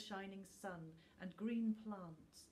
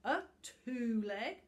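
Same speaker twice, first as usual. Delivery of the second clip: slow, louder mocking question; sneering; 4 semitones higher, 8 dB louder, slower than usual. A narrator's reading. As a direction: sneer the word at him. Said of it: "sneered Book".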